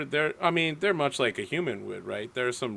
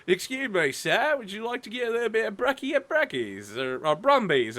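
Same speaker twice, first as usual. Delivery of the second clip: high, polite mock-Australian concierge; imitated Australian concierge; 8 semitones higher than usual. Concierge offering brekkie to guest, high mock Australian. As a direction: imitate a helpful Aussie hotel clerk. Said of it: "the concierge at each hotel would be like".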